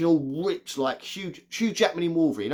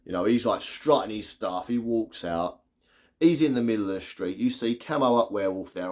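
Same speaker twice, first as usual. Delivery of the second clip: usual speaking voice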